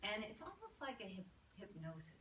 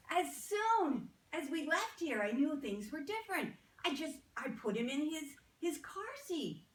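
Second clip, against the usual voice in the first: excited retelling; 4 semitones higher, 12 dB louder than usual. Retold or imitated. Mother excitedly reports the change.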